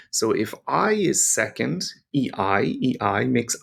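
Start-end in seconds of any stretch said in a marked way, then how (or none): none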